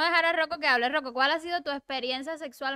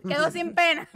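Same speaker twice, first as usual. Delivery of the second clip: chuckling through the joke; laughing; 2 semitones higher than usual. Real, not acted. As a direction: say it while laughing. Joking about the castration story.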